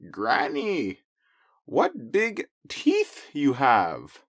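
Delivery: put-on slow wary little girl voice; a nervous child drawing it out. Red questions again.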